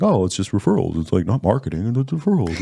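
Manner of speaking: lightly voiced dopey moose. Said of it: "your Tom voice is the moose voice".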